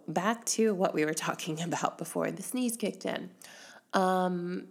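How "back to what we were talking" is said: ordinary delivery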